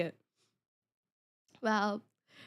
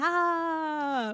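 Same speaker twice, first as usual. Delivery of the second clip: normal talking voice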